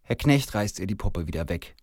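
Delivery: ordinary delivery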